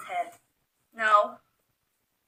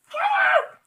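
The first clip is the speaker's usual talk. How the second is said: shout; 11 dB louder than usual. Real, not acted. Shooing pet away.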